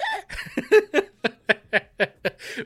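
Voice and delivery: loud high burst of laughter; laughing. Real, not acted. Comedian laughing at his own road rage, big laugh. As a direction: crack up at yourself.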